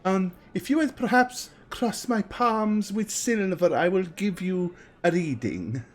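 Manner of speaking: put-on high, slow, theatrical old fortune teller. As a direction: drawl it out like a mystical seer. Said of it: "He says".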